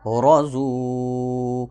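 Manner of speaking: رزو stretched out very slowly; slow joined demo; very slow reading. Shown as a demonstration. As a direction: draw out رزو syllable by syllable. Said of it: "ضاد واو پیش زو،".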